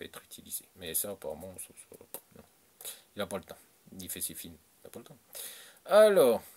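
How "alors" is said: sudden shout to restart; loud call. Real, not acted. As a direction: call the room back loudly. Loud call after pause.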